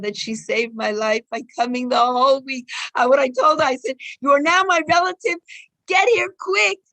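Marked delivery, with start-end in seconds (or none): 4.2-6.8 higher, louder, urgent order; urgent summons. Retold or imitated. Speaker retells calling a friend over for the holiday, urgent summons. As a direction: summon a friend in a hurry, voice raised.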